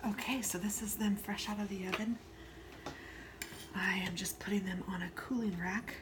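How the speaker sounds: soft hushed whisper so the dogs keep sleeping. Real, not acted. Baker whispering to viewers while her dogs sleep, hushed. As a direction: breathe the words, barely voiced. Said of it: "so I'm kinda whispering just because".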